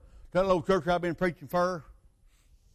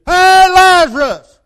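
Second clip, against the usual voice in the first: slow, booming high shout of command; 12 semitones higher, 16 dB louder, much slower than usual. Retold or imitated. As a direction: call the dead man out with full force. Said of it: "He didn't do it like, eh, people go".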